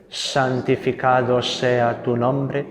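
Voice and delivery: normal talking voice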